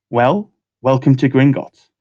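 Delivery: usual speaking voice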